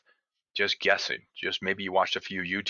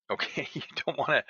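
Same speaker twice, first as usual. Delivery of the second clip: amused chuckle through the words, pitched up; laughing; 6 semitones higher than usual. Real, not acted. Teacher laughing at his own surgeon joke, amused laughing. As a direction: laugh as you say it.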